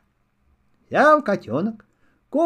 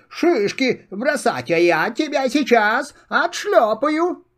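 scolding squirrel; 6 semitones higher than usual. Acted. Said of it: "закричала белка".

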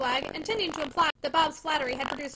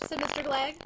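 plain reading voice

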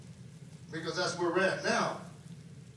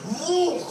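a high, loud whoop of joy; joyful whoop; 10 semitones higher, 8 dB louder than usual. Retold or imitated. Preacher whooping as he is suddenly healed, high and loud. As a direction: let out a shout as the power hits.

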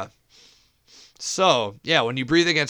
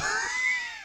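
a sudden high squealing laugh burst; 6 dB quieter than usual. Real, not acted. Host cracking up at his five-listeners joke, squealing laugh.

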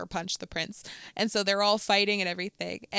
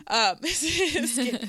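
laughing; 6 semitones higher, 4 dB louder, slower than usual. Real, not acted. Jokes about own British accent.

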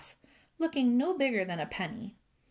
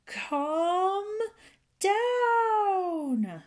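high, long-held holler on each word; loud cry; 10 semitones higher, 6 dB louder than usual. A narrator's reading. Fairy on the church roof to the old man, crying loudly. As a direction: holler it as loudly as you can, stretching both words. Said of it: "cried as loudly as ever he could".

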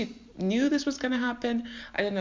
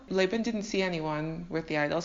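ordinary delivery

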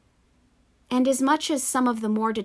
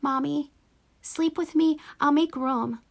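small trembling voice; 6 semitones higher than usual. A narrator's reading. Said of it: "in a small, trembling voice".

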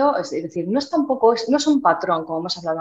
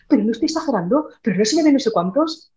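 higher, quick worried objection; mock protest; 4 semitones higher, 4 dB louder than usual. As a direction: imitate a client protesting they look overdone. Acting out clients' doubts when coached.

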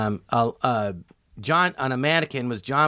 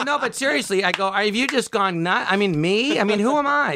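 pleading protest; 7 semitones higher than usual. Retold or imitated.

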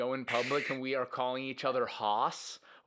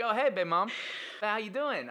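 a high, breezy mock greeting; casual greeting; 9 semitones higher than usual. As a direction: toss it off like the most normal thing. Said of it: "he said it was such".

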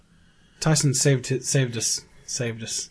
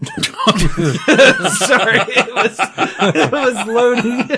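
high-pitched laughing, slowed words; 9 semitones higher, 5 dB louder, much slower than usual. Real, not acted. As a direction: giggle out the apology. Laughing through an apology.

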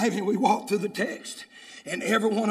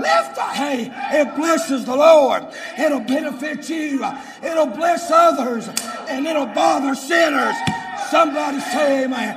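shouted sermon, building higher and louder; full-force preaching; 8 semitones higher, 11 dB louder than usual. Real, not acted. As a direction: cry surrender out to God.

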